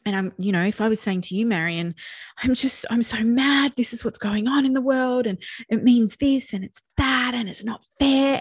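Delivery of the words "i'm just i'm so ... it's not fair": worked up and indignant, higher and louder; acted-out angry protest; upset venting. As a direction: vent like someone fed up with the world. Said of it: "And I'm yelling and getting upset".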